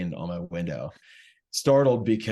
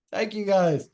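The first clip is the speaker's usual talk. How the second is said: put-on high, strained drunken thank you; drunk squeaky thanks; 12 semitones higher, 5 dB louder than usual. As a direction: squeak out thanks mid-vomit.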